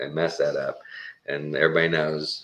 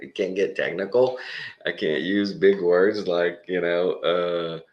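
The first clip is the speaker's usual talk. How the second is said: a touch of mocking disbelief, slower; incredulous; 2 semitones higher than usual. Guest mocking the ban on technical talk, incredulous. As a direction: ask it slow, half-mocking.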